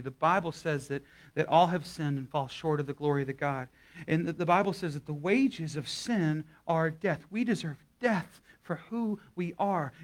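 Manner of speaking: ordinary delivery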